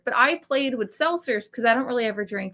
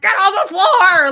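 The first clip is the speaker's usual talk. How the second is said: high, very loud mock raid command; retold robber's yell; 8 semitones higher, 10 dB louder than usual. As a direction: yell it like bursting through a door. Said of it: "he just fucking kicks open the door".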